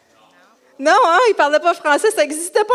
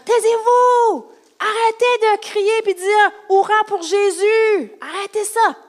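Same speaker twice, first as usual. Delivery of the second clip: angry shouting; 7 semitones higher than usual. Acted. Pharisees ordering the cheering crowd to stop, angry shouting. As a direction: shout them down in hammed-up outrage.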